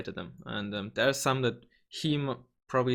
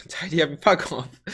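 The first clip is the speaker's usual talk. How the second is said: laughing; 4 semitones higher, 7 dB louder than usual. Real, not acted. Jokes about tidying messy desktop.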